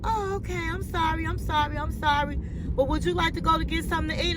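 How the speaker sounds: a timid, pleading man who won't give up; imitating the pushy suitor, meek yet persistent; meek apology.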